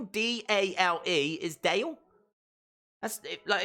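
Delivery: normal talking voice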